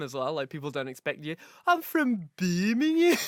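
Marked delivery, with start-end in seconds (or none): 1.7-3.2 put-on slow, drawn-out Birmingham accent; Brummie accent. Joking about regional dialects, slow Brummie accent. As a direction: lean into a thick Brummie drawl. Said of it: "same thing when you go to, like, Birmingham".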